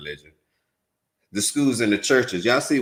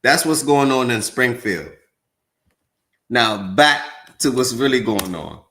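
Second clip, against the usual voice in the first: louder, emphatic announcement; loud wrap-up; 3 semitones higher, 6 dB louder than usual. Real, not acted. Streamer loudly closing the bit to viewers. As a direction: announce it to the chat.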